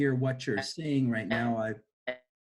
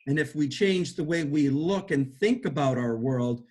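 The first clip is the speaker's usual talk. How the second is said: ordinary delivery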